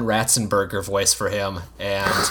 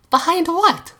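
put-on high squeaky kid voice; squeaky little girl asking. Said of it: "daughter Louise voiced in story".

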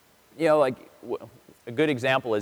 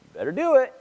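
insistent dad; 6 semitones higher than usual. Retold or imitated. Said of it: "I'm like".

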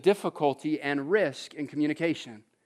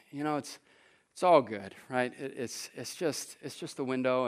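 gentle wife; 7 semitones lower, 8 dB quieter than usual. Retold or imitated. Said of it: "she's like".

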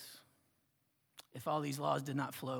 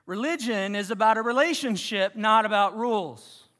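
common saying; 5 semitones higher, 12 dB louder than usual. Retold or imitated. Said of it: "How often do you hear".

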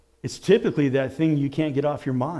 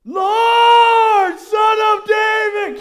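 much higher, loud desperate yell; louder shout; 9 dB louder, much slower than usual. Retold or imitated. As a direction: scream it over everyone. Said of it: "shouted louder".

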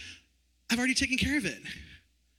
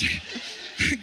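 awkward laugh; 3 semitones higher than usual. Real, not acted. Awkward reaction to neighbor.

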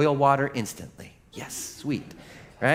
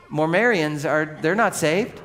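usual speaking voice

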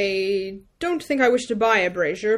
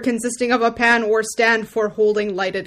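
reading definition; 2 semitones higher, 8 dB louder than usual. Real, not acted. Reads dictionary definition aloud.

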